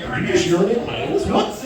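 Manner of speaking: fast, incredulous quip; mock outrage.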